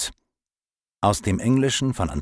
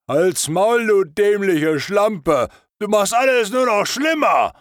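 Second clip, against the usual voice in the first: high, loud furious snarl, slowing on the insult; angry scolding; 14 semitones higher, 6 dB louder than usual. Acted. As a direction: put her down hard and loud. Fat one snaps at the redhead. (wütend und laut, hoch angeschrien)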